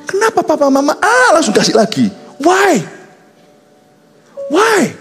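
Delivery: preaching at full force. Acted. Preacher rebukes parents.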